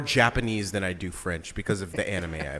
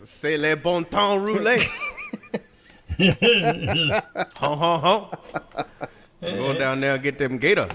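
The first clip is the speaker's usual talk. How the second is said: put-on Cajun accent; 7 semitones higher, 5 dB louder than usual. Hams up Cajun lines after Acadian talk.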